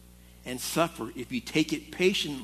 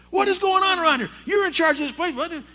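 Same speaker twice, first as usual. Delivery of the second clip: higher, louder, fast imitated boss rant; retold yelling; 12 semitones higher, 5 dB louder, faster than usual. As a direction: act out the district manager yelling at the acting manager. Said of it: "he's yelling at me".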